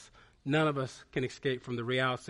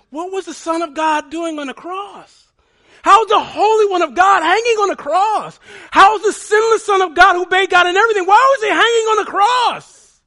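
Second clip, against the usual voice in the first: much higher and louder, near a cry; climactic preaching shout; 17 dB louder than usual. Acted. Pastor confronting congregation with the cross, peak shouting. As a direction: hurl each question at the hall.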